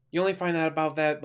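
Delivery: ordinary talk, nothing marked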